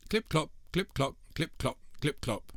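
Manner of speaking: high bouncy hoof sounds; sing-song clip-clop. A narrator's reading. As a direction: tap it out like little hooves.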